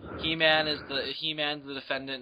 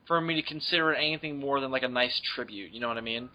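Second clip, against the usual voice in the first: normal talking voice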